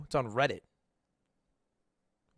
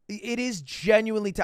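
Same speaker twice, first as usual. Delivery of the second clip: ordinary delivery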